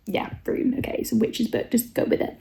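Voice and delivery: whispered aside, no voice at all. Real, not acted. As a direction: breathe it like a secret to the viewers. Giggly aside about love interest.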